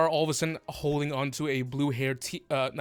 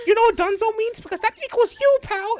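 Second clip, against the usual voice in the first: high-pitched little rat taunt; high, cocky rat voice; threatening rat; 5 dB louder than usual. Acted. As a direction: threaten as a tiny, squeaky rat. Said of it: "And he goes".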